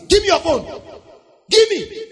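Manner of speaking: high, loud mock-robber shout; robber's demand. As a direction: demand it like a robber holding up a victim. Acting out an armed robber's demand.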